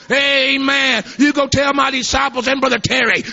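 high, loud shouted preaching; exultant revival preaching; preaching at full force. Acted. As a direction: proclaim it like a revival climax.

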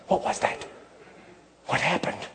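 hushed, breathless whisper of alarm; a startled woman whispering in fear; frightened whisper. Acted. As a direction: whisper it like you're scared.

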